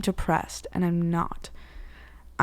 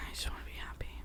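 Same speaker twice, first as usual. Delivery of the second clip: whispered wish; 19 dB quieter, much faster than usual. Real, not acted. Wistful life wish.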